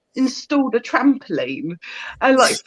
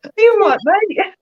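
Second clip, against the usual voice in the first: much higher, louder pet-talk; 10 semitones higher, 4 dB louder than usual. Real, not acted. Owner calling to her dog mid-call, high louder pet voice. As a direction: coo at the dog checking on it.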